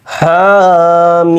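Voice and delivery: Hamim chanted very slowly, মিম held long; Quranic recitation. Shown as a demonstration. Teacher demonstrates the long মিম to students, chanted recitation. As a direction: stretch Hamim out in one sung breath. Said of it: "এবার দেখেন".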